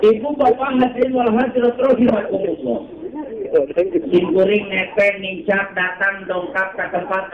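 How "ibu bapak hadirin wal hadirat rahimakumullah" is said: raised formal greeting to the congregation; preaching address. Acted. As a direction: call out to the crowd like a preacher. (ceramah berkhotbah)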